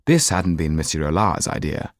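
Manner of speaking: ordinary delivery, nothing marked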